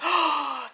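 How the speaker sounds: sharp gasp of shock. Retold or imitated. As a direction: gasp in surprise. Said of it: "They like".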